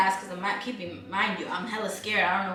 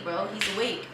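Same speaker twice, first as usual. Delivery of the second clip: usual speaking voice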